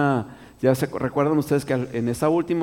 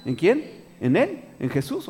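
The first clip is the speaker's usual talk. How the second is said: ordinary delivery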